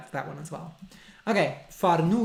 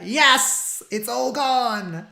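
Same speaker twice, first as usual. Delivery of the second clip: higher, louder, slow exclaiming; excited; 7 semitones higher, 8 dB louder, slower than usual. Real, not acted. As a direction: announce it with excitement. Excited answer about haircut.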